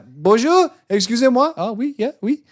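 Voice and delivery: high, loud fake French accent; comic French accent, high and loud; mock French accent. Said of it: "It's like".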